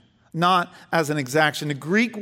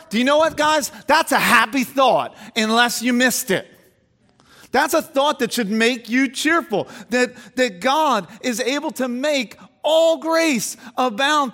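impassioned preaching; 6 semitones higher than usual. Acted. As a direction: preach it with rising fervor. Preaching at full force.

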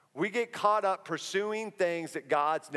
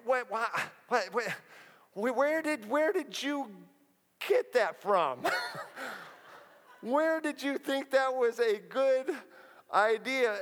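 higher, halting, baffled voice of God; a hint of a bewildered God; 4 semitones higher than usual. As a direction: stammer like a God lost for words. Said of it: "I think God's like".

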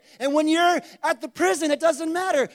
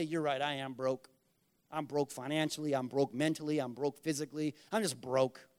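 much lower and softer, a beaten-down agreement; defeated mumble; 10 semitones lower, 8 dB quieter than usual. Acted. Believer giving in to the enemy, low defeated mumble.

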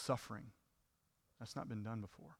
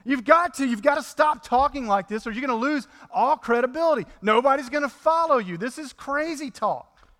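higher, louder pleading as Peter; a disciple urging his master, higher and louder; Peter's desperate plea; 6 semitones higher, 5 dB louder than usual. Retold or imitated. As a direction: beg Jesus to stop, voice pushed up high. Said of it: "here's Peter, maybe his plea".

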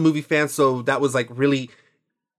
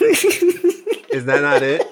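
laughing; 4 dB louder, much slower than usual. Real, not acted. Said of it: "asking if impression works".

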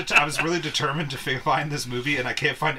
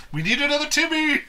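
excited; 12 semitones higher, 4 dB louder than usual. Pretend. Mock lab banter.

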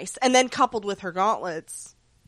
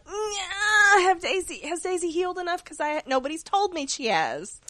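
worried high whine; 8 semitones higher than usual. Retold or imitated. Retold worried inner voice.

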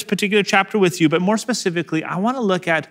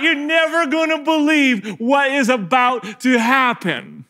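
jeering soldier; 11 semitones higher than usual. Acted. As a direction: whip up the crowd like a cocky soldier. Preacher voices mocking soldiers calling out.

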